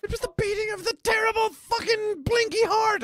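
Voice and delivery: hammed-up Poe recitation.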